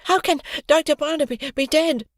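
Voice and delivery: about to fall to pieces; trembling worry. A narrator's reading. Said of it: "trembling voice".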